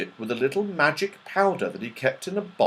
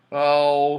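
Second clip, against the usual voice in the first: plain reading voice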